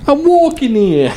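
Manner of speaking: put-on high, laughing New York accent; hammed-up New York cabbie. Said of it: "You gotta stay with a New York accent".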